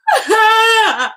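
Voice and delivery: loud, high cry-laugh burst; loud, high laughing sob; sobbing laugh. Shown as a demonstration. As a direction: let a laugh break out of the tears. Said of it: "what I just showed you".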